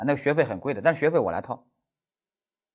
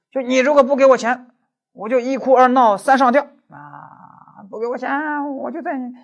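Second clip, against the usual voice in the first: threatening mistress; 13 semitones higher, 8 dB louder than usual. Pretend. Actress threatens boss for money.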